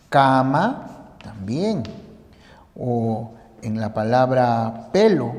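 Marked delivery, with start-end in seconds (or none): none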